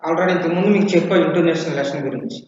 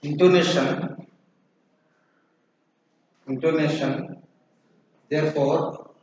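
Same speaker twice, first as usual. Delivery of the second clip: slow, measured classroom dictation; 5 dB quieter, much slower than usual. Real, not acted. Teacher dictating intonation notes for students to write, slow dictation. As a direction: dictate in short repeated chunks with long pauses.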